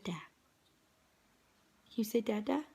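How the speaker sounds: very slow drawn-out whisper. Real, not acted. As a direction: stretch each word in a hush. Coaxing baby to talk.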